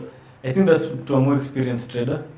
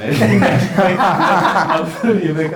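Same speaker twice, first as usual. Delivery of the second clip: higher, louder, broken up by laughing; laughing apology; 4 semitones higher, 5 dB louder, much slower than usual. Real, not acted. Co-host apologising to the others mid-laugh, laughing. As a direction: apologise through helpless laughter.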